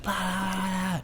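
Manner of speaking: high, soft mocking babble; mock her complaining, high and soft; a sing-song parody of a whining complaint; mocking blah blah. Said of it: "was like".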